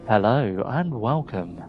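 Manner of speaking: slow announcer greeting; a first try at a voiceover welcome; radio voiceover. Shown as a demonstration. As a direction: ease in like a late-night radio host. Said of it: "I could do voiceovers".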